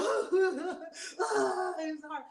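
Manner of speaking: high, mock broken-up sobbing with a laugh. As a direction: parody of a grieving mother falling apart. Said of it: "she wasn't all broken up like".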